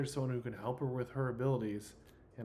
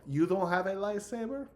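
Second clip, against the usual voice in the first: put-on high, louder French-accented question; 8 semitones higher, 7 dB louder than usual. Character questioning a player about the lightsaber, French accent. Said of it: "I could do this French accent".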